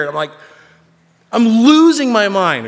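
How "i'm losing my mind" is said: loud, high, slow wail; hammed-up losing control; mock breakdown. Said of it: "when I cry".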